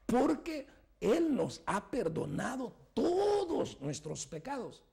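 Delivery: swelling sermon climax; fervent high preaching. Acted. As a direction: climb high on perdonado.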